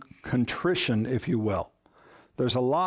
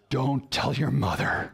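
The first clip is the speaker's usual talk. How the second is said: stern parent; 3 semitones lower than usual. Retold or imitated. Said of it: "you look very sternly and say".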